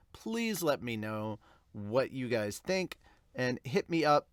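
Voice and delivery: ordinary talk, nothing marked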